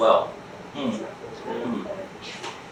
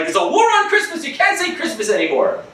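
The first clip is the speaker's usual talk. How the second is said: mock outrage; 10 semitones higher than usual. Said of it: "They're like".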